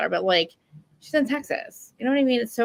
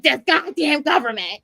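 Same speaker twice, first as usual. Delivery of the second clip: higher, slow grumbling curse; mock exasperation; 6 semitones higher, 4 dB louder, slower than usual. Recalling her angry awakening stage, mock fury at government. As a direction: grumble it in hammed-up anger.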